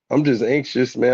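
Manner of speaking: ordinary talk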